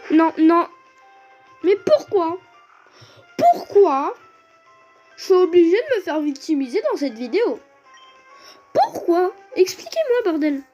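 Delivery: high, loud indignant rant; raging gamer. Real, not acted. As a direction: demand answers in outrage. Rage at being victimised by game.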